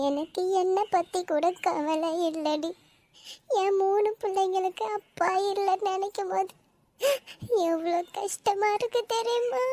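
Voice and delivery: soft, choked-up confession; hushed, sorrowful lament; tearful. Acted. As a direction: pour out grief quietly through tears. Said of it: "அழாதடி".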